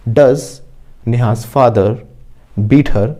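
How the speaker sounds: very slow, word by word, for students to repeat; slow model sentence. Shown as a demonstration. Teacher modelling an answer for the class, very slowly. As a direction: spell each word out slowly like a dictation.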